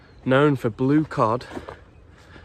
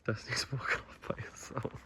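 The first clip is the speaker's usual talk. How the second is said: whisper; 14 dB quieter than usual. Real, not acted. Quiet aside while filming.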